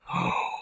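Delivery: short soft gasp; quick, quiet gasp of reaction. Real, not acted. Reader reacting to the manga chapter, soft gasp.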